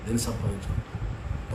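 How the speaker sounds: "దేని సంపాదించుకుంటున్నావు" dropped low and quiet; soft repeated question. Real, not acted. ("దేని సంపాదించుకుంటున్నావు" నెమ్మదిగా, తక్కువ స్వరంలో)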